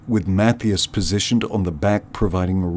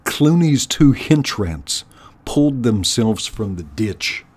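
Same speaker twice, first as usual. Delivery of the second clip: ordinary delivery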